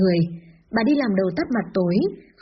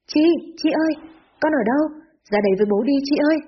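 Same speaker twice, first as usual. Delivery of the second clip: higher-pitched searching call, narrated; 5 semitones higher, faster than usual. A narrator's reading. Worried father searches the garage for his daughter, calling out. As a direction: call for a hiding child, voice raised in pitch. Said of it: "không muốn lên tiếng khi bố gọi".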